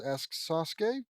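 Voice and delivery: usual speaking voice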